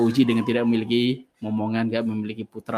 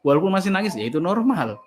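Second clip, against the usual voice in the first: ordinary delivery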